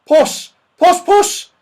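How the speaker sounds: high, loud calls of puss; calling a cat. Acted. Saucepan man searching for a cat, calling loudly. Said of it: "he called".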